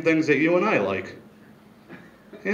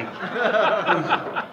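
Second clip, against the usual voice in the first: real laugh; 7 semitones higher, 3 dB quieter than usual. Real, not acted. Laughing at his own bit.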